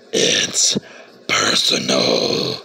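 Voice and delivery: deep slow monster voice; scary voice. Pretend. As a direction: growl it like a movie monster. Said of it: "I promise I'll stop using that scary voice".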